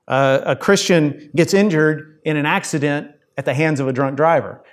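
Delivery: ordinary talk, nothing marked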